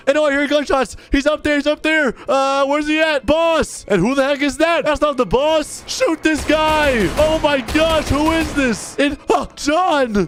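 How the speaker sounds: real panic in the middle of a gunfight; shouting for the boss in alarm; panicked. Real, not acted. Panicked firefight, calling to John.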